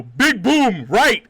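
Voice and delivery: loud, high pidgin-style callout; simplified shouted instruction. Retold or imitated. Telling a Brazilian teammate who didn't understand, loud mock callout. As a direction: punch out each simple word for a foreigner.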